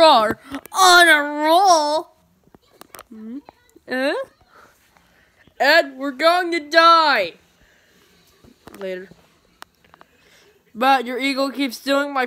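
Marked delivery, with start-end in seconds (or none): none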